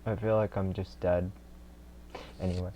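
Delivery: ordinary talk, nothing marked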